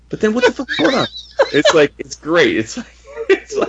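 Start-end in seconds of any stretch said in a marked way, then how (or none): 0.1-1.1 high-pitched comic confusion; mock bewilderment. Retelling Clint confused by Daredevil, high bewildered voice. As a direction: pitch it way up, utterly baffled.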